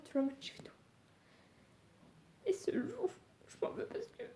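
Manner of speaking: sobbing, barely voiced; snotty crying. Real, not acted. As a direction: voice breaking into tears, high. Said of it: "Je suis désolée si je pleure".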